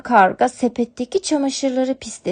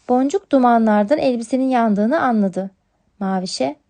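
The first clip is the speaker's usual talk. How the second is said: usual speaking voice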